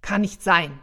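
loud, blustery denial; blustering. A narrator's reading. Santa refusing to believe the elf, blustering. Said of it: "polterte ich".

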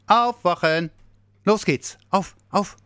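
high, loud rousing calls; wake-up call. A narrator's reading. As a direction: jolt a sleeper awake. Waking the sleeper from his dream. (lauter, heller Weckruf)

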